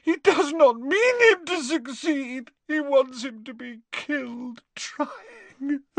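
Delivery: high, anguished voice; despairing Narcissa. A narrator's reading. Narcissa telling Snape her son will die, anguished.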